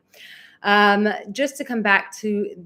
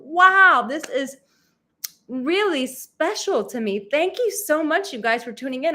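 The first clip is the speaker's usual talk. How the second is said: excited thanks; 6 semitones higher, 3 dB louder than usual. Real, not acted. Still thrilled by viewers.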